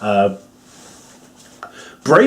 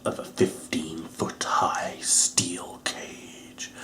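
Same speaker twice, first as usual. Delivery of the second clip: whisper; 7 semitones lower, 16 dB quieter, much slower than usual. Acted.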